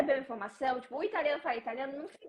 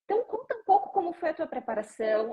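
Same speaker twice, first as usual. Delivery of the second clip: ordinary delivery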